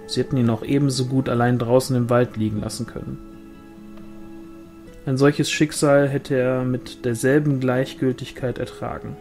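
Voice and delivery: usual speaking voice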